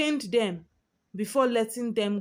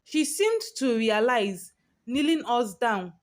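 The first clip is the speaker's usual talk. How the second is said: plain reading voice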